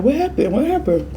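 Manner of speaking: high, eager "what happened"; high excited asking. Retold or imitated. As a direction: squeak out the question twice. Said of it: "I'm like".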